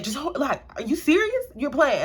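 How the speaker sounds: a mom who can't believe it; mom's stunned, disbelieving reaction to the news; shocked disbelief. Retold or imitated.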